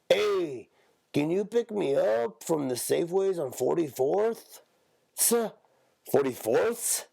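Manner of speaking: put-on high, Mexican-accented request. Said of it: "Mexican dudes also do this".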